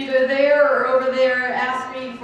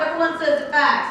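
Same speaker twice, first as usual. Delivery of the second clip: normal talking voice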